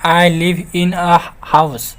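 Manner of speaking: very slow, word by word; slow dictation; slow reading. Shown as a demonstration. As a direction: read the example for learners. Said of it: "teacher reads shorter example".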